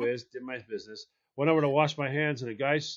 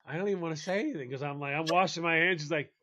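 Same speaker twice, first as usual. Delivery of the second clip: ordinary talk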